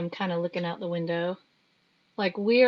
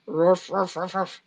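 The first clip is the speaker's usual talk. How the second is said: playful dog barks; 3 semitones lower than usual. Pretend. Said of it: "I'm a dog".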